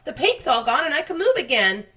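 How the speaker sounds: high, loud gleeful cries; overjoyed. Acted. The centipede cleaned by the rain, high joyful shouting. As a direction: crow with delight at being free.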